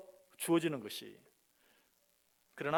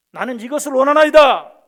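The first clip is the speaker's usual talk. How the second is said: loud, high bold declaration; firm reply; 9 semitones higher, 21 dB louder than usual. Acted. Believer answering God what he wants, loud and bold. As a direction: answer God boldly and clearly.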